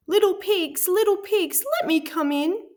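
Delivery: high, polite pleading wolf voice. A narrator's reading. Wolf politely asks the pig to let him in, high and sweet. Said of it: "He knocked politely on the door and said".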